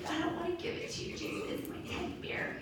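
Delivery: put-on small, soft, high little girl pleading; a child clinging to her teddy, mild and soft. Girl tells Jesus she won't give up her bear, small and soft.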